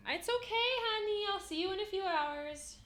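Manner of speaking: high loud soothing call through the door; reassuring the cat. Real, not acted. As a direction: comfort the kitty through the door.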